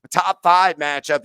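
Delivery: ordinary talk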